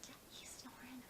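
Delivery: hushed whisper, voice off. Real, not acted. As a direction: whisper so he stays asleep. Whispering while friend sleeps.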